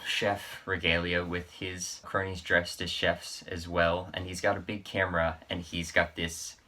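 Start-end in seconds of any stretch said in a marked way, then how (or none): none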